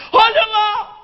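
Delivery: high, loud tearful shout; crying fan shouting. Retold or imitated. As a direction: yell it through tears. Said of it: "apontou pra pista e disse". (fã gritando e chorando)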